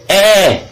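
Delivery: Eh held long and high, then falling; sustained Eh, as a demonstration. Shown as a demonstration. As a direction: draw out the open vowel Eh. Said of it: "la formation de certaines voyelles longues".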